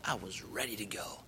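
soft whisper of surrender. Retold or imitated. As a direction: breathe it out, calm and ready. Facing death, at peace.

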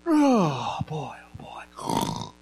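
sighed words fading to unvoiced breath; weary settling sigh. Eli lying back on his bed, play-acted weary sigh. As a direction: sigh it out as you sink down.

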